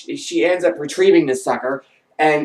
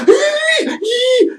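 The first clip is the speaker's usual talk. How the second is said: very high drawn-out "eee" squeals; imitated squeal. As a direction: mimic someone squealing helplessly. Imitates her squeals while working.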